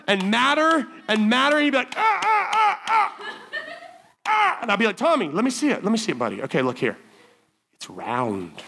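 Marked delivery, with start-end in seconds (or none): 2.0-4.6 put-on high, strained, frustrated kid noises; high straining ahs of an angry child; frustrated kid grunts. Tommy straining at the shape. 5.0-8.6 gentle coaxing. Retold or imitated. Gently guiding Tommy.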